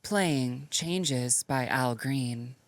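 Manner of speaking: very slow, even robotic AI voice; feminine robot voice. Pretend. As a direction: announce it like a calm robot assistant. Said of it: "kinda robot voice I've been working on".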